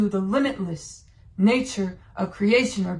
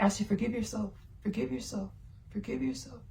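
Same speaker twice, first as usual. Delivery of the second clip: soft, fading, tender self-forgiveness, softer; soothing inner soul voice; 11 dB quieter than usual. Retold or imitated. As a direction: say it to yourself like a comfort, dropping away. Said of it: "my soul was like".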